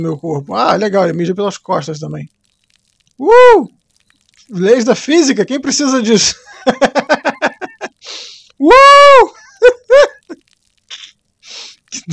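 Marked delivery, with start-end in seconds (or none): 3.2-3.7 high surprised yelp. Real, not acted. Reacts to physics glitch. 8.6-9.3 loud high yelp of surprise; high surprised yelp. Real, not acted. Reacts to physics glitch.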